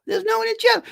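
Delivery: very high, squeaky mock dismay; comic falsetto complaint; mock squeal. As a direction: squeak it out as a joke lament. Squeals repeat about empty chat.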